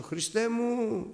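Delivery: high, slow cry for help; drawn-out, loud "Χριστέ μου". Retold or imitated. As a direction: call out to Christ in trouble. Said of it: "φωνάζω".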